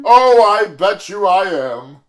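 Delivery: drawn-out cocky brag; slow smug boast. Acted. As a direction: savor the compliment smugly. Suitor boasts.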